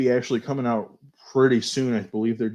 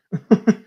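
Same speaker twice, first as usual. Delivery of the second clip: short laugh; 11 semitones higher, 7 dB louder than usual. Real, not acted. Laughs at green accents.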